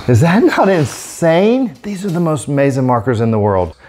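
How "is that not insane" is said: high, slow amazed question; drawn-out disbelief. Real, not acted. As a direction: marvel at the result. After slow-mo demo.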